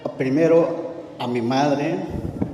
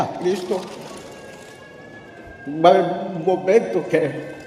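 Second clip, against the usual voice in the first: preaching; 2 semitones higher than usual. Acted. Preaching testimony to listeners.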